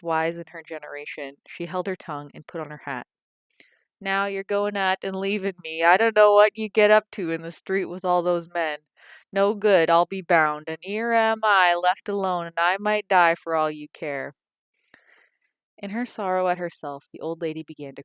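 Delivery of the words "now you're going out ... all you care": guilt-trip her as she leaves; self-pitying wail. A narrator's reading. Mother wailing at daughter leaving, loud and self-pitying. Mother laments being left.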